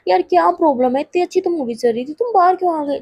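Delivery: lightly acted exasperation; mock annoyed complaint. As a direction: complain to a friend who walked out. Acted friend complains at being followed out.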